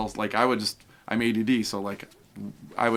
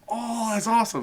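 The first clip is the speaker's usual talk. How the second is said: excited; 12 semitones higher than usual. Retold or imitated. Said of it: "I'm like".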